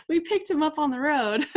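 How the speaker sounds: mock deadpan joke. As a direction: play the mother's joking answer. Joking mother's reply.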